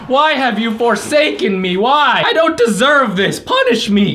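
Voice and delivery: high-pitched mock lament; melodramatic anguish. Player asking why he is forsaken, melodramatic. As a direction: plead to the heavens, hammed-up.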